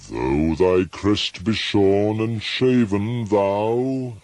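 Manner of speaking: high, loud, grand address to the bird. Acted. Narrator addressing the stately raven, loud and grand. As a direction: declaim at the raven.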